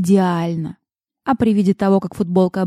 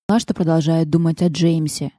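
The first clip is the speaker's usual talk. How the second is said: plain reading voice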